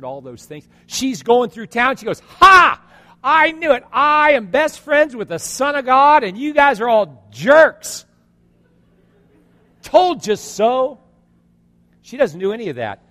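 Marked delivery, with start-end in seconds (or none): 2.4-11.0 high, loud hammed-up taunt; gloating yell. Woman yelling at townspeople who shamed her, gloating. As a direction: crow it across the town, rubbing it in. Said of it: "she starts yelling at everybody".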